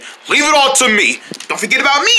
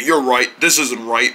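normal talking voice

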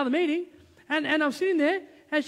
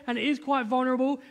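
ordinary delivery

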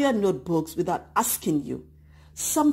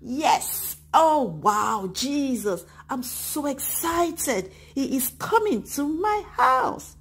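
delighted; 6 semitones higher, slower than usual. Acted. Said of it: "Or you'll be delighted".

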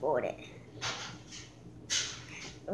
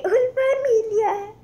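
exaggerated high call; 11 semitones higher, 9 dB louder than usual. Singing along with app.

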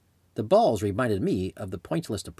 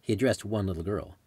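usual speaking voice

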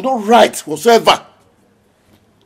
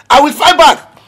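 louder, higher repeat of the vow, FIGHT hit hard; angry vow; 7 semitones higher, 5 dB louder than usual. Acted. Father vowing revenge for his son, angry shout. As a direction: hurl the threat back louder.

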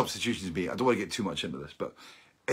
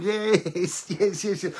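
high cheering yeses; excited; 9 semitones higher, much slower than usual. Retold or imitated. Cheering the goal in the room with Simona, high and excited. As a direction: celebrate the goal.

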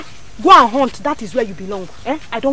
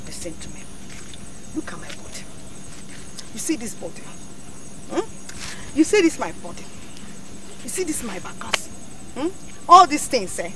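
seductive hush; 3 semitones lower, 8 dB quieter than usual. Acted. Woman tempting the hunter with her body, soft and seductive. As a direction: draw him in with a low hush.

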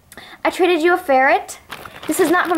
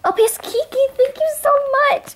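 high thanks; 11 semitones higher than usual. Real, not acted. High-pitched thanks at the end.

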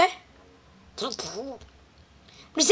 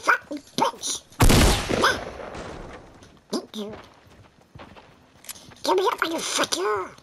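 Donald Duck voice; 3 semitones higher, 3 dB quieter than usual. Acted. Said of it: "I'm doing a Donald Duck video".

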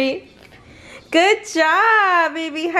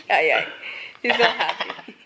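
laughing; 9 semitones lower, 5 dB quieter than usual. Real, not acted. Mom laughing at baby.

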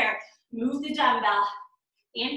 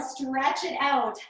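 ordinary delivery

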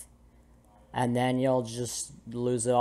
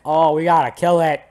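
raised, louder mock alarm; imitated alarmed attacker; 4 semitones higher, 7 dB louder than usual. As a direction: act out his call to attack. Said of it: "here he is like".

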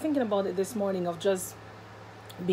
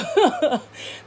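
short laugh; 6 semitones higher, 4 dB louder than usual. Real, not acted. Laugh after painful story.

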